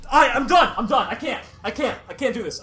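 exasperated giving up. Real, not acted. Gamer declaring she can't do this, exasperated and giving up. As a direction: throw in the towel, losing steam.

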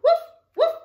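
two high, loud woofs; dog bark. A narrator's reading. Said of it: "barked the dog".

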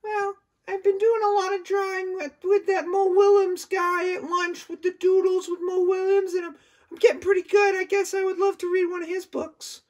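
very high, shy little dog voice; high timid puppet, afraid of the shark; timid puppy puppet. Acted. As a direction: squeak it out like a nervous puppy. Furball puppet talks about drawing.

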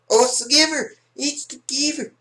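very high falsetto outcry; squealed alarm. Pretend. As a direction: squeal it as you spot a rival. Spots known player, squeals.

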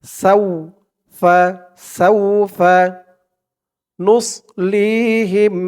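slow, melodic tajweed recitation; slow Quranic chanting. Shown as a demonstration. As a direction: chant each word long and drawn out. Recites verse after breakdown.

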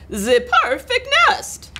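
high-pitched French-style duck voice; excited duck cry. A narrator's reading. Duck admiring the nest, high excited cry. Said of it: "she cried".

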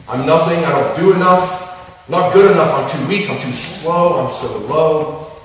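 rising despair at full force; anguished outcry. Acted. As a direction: wail the lies as if believing them. Crescendo of voiced self-talk.

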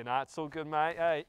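normal talking voice

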